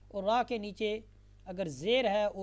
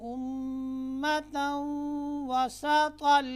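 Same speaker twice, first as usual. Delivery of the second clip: slow melodic chanted recitation, higher and louder; full recitation; 4 semitones higher, 7 dB louder, much slower than usual. Shown as a demonstration. Teacher recites the complete verse again for students. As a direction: recite the whole verse slowly in tones. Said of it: "अब हम दोबारा मुकम्मल पढ़ते हैं".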